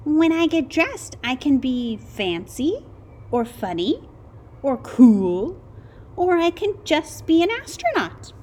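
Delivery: high, loud, excited little voice; eager kid listing his dreams; Wilbur's voice. A narrator's reading. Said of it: "replied Wilbur".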